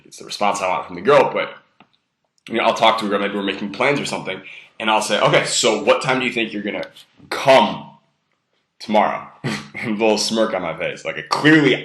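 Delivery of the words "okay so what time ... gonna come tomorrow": "come" leaned on hard, then a pause; stress on COME. Shown as a demonstration. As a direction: hit the word "come" and let it hang. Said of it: "put a lot of emphasis on the word come".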